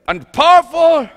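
high, loud mock-grand wizard; booming Oz voice. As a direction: puff it up like the great Oz. Echoes Oz's grand voice.

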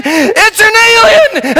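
high, slow hammed-up alarm cry; mock terrified cry. Technician announces the alien in a hammed-up cry. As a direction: wail it like a fake panicked technician. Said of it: "And then she said".

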